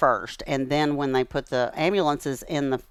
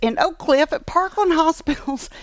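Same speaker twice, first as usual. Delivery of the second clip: wide-eyed paternal disbelief, pitched way up; incredulous dad; 14 semitones higher than usual. Retold or imitated. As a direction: ask it like a dad who can't believe his ears. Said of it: "and he said".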